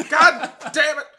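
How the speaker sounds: high, loud laughing curse; laughing shout. Retold or imitated. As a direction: bellow it while laughing. Topping the joke.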